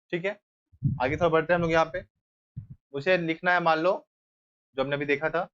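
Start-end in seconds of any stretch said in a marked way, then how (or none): none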